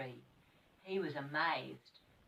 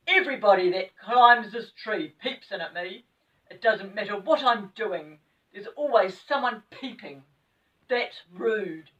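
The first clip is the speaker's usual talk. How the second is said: loud scolding little man; a cross pixie complaining; angry pixie; 3 semitones higher, 11 dB louder than usual. Acted.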